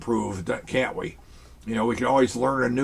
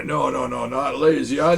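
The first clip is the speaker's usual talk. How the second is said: normal talking voice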